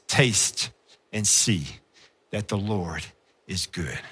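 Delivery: low, near-whispered verse; soft reverent reading fading to silence; hushed scripture. Acted. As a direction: savour each word softly. Scripture read in a fading hush.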